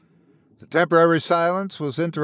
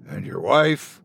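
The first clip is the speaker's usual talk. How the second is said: deep creature voice; 6 semitones lower than usual. Acted. Shadow creature questions him.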